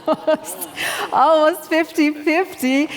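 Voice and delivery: laughing through the words, pitch up; amused disbelief, chuckling it out. Real, not acted. Host laughing at a split audience poll, laughing.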